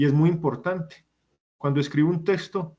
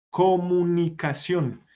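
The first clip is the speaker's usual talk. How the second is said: comunicación slowly, stretched through to final -ción; drawn-out demonstration; 3 semitones higher, slower than usual. Shown as a demonstration. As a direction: stretch comunicación, falling on the last syllable. Teacher shows the stressed syllable. (comunicación alargada, como ejemplo)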